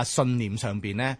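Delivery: ordinary talk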